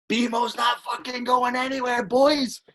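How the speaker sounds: high, slow gleeful shout; triumphant celebration; excited. Retold or imitated. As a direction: whoop it out to the boys. Celebrating with teammates after news.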